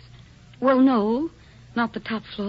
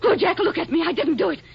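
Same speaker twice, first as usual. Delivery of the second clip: higher, urgent denials; desperate pleading; 6 semitones higher than usual. Acted. Wife denying to her husband, desperate and pleading. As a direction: beg him to believe you.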